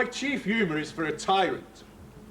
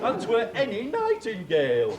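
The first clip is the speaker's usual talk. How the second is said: usual speaking voice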